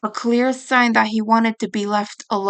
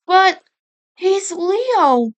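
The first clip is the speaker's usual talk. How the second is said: high, louder whine; whining; 9 semitones higher, 3 dB louder, much slower than usual. Acted. Mikey whining to Raph about Leo, high whine. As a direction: whine it like a hurt kid. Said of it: "he whined".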